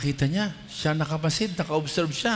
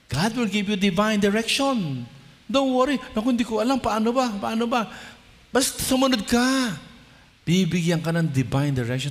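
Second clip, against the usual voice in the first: high, loud preaching push; full-force preaching; 10 semitones higher, 3 dB louder than usual. Acted. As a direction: drive the promise home to the congregation.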